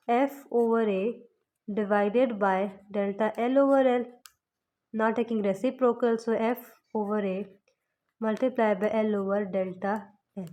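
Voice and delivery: slow, word by word, formula read out for notes; slow dictation. Shown as a demonstration. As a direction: spell the formula out slowly for the class. Teacher dictating formula rearrangement. (धीरे-धीरे formula लिखवाते हुए)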